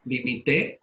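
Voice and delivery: "Limité" as li-mi-TÉ, stress on TÉ; stress on the last syllable. Shown as a demonstration. Teacher confirming the answer, stress on TÉ in "Limité". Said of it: "lleva acento en la E".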